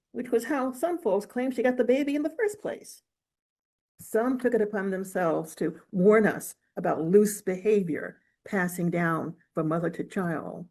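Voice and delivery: ordinary delivery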